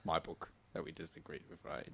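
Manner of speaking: normal talking voice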